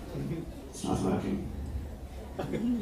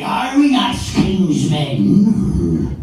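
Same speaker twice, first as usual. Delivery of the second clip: very slow, loud, high Irish villain; Barbossa; 7 semitones higher, 7 dB louder, much slower than usual. Acted. Pirate villain proclaims it, loud drawn-out Irish voice. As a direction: drag out every word like a pirate villain. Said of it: "Everything he has to say".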